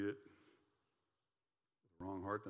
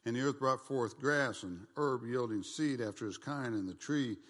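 scripture reading; 3 semitones higher, 8 dB louder than usual. Acted. Preacher reading Genesis to the congregation, loud and ringing. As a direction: proclaim the verses to the congregation.

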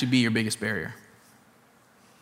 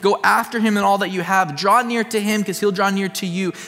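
higher, louder, driving sermon climax; fervent pulpit preaching, raised and urgent; preaching at full force; 5 semitones higher, 3 dB louder than usual. Acted. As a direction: build it up like a revival preacher.

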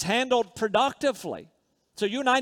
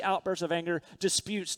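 ordinary talk, nothing marked

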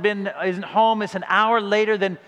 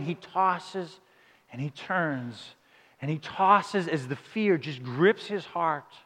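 hushed storytelling; 4 semitones lower, 6 dB quieter, much slower than usual. A narrator's reading. Paints David's sleepless fear.

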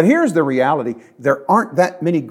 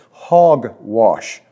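scornful dismissal; 5 semitones lower than usual. Real, not acted. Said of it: "Sorry, that's a Greek word".

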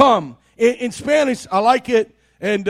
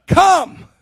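one loud, higher bark of a word; stern command; 6 semitones higher, 5 dB louder than usual. Retold or imitated. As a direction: snap the order like an impatient parent. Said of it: "It's more like".

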